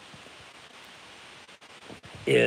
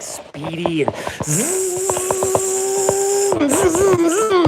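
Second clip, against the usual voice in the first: high slow buzzing zzz; drawn-out high buzzing noise; buzzing wired; much slower than usual. Retold or imitated. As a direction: buzz like a body on speed. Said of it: "I'm all, like".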